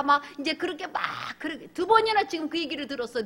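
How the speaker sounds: ordinary talk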